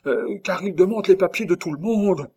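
high, loud urgency; anxious warning. A narrator's reading. Nurse warning that papers are checked, high anxious voice. Nurse warns the wanted man.

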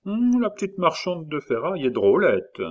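high, lilting musing quote; quoting an appreciative schemer. A narrator's reading. Lambert imitates the plotter eyeing the girl, relishing. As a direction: start on a high, relishing hum. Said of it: "il avait dit comme cela".

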